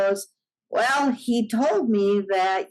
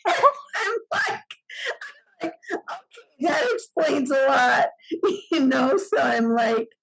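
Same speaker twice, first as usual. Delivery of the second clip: laughing through the words; real giggles as she talks; amused disbelief, laughing; 5 semitones higher than usual. Real, not acted. Laughing while telling Bigfoot story.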